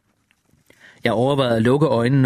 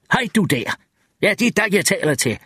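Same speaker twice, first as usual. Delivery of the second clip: calling out; 7 semitones higher than usual. A narrator's reading. Wireman calling to the creature.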